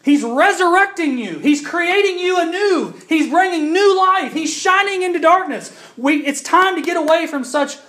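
loud, high pulpit proclamation; full-force preaching. Acted. To the congregation about creation, preached loud. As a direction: preach it out with conviction.

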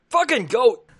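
high, loud curse in character; angry outburst. Acted. DeSoto curses the goat he tripped over, angry outburst. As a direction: yell it out in exasperation.

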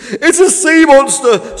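high, loud, drawn-out scream. A narrator's reading. Fish spots something in the water, screaming high. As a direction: scream the alarm, stretching it out. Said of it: "screamed F".

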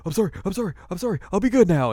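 high, rapid begging apology; pleading weasel. Retold or imitated. Weasel demon begging Moroku not to suck him up, high. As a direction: grovel in a squeaky plea.

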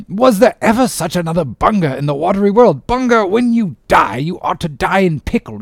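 high, hearty mock abuse; bantering sea captain. Captain cursing his rascal surgeon, jovial and high. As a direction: call an old friend names with relish.

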